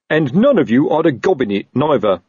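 a touch of an angry officer's shout. A narrator's reading. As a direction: call it out crossly. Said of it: "shouted Colon".

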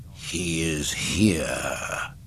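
low, very slow dark lord; Darth Vader. A narrator's reading. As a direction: state it slowly and ominously deep. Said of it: "Vader stated".

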